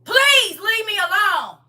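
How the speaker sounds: high, loud, begging cry of please; desperate pleading shout. Retold or imitated. To her husband at the locked door, a loud desperate plea. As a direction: beg him at full voice to leave. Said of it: "I said".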